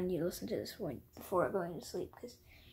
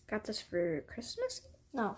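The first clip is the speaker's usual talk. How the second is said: ordinary talk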